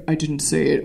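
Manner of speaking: lightly put-on deep man's voice; deep sexy voice. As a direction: say it deep and seductive. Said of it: "with his deep, sexy voice".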